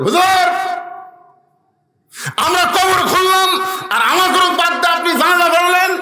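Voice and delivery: high, loud mock complaint; acted shouted protest. Gravediggers protesting to the imam who left them out, high shouting. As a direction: yell it as an aggrieved objection.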